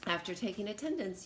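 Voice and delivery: ordinary delivery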